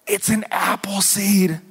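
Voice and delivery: slow hushed whisper of wonder; little boy whispering in awe over an apple seed; awed whisper. Retold or imitated. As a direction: breathe it like a secret treasure. Child's awed discovery.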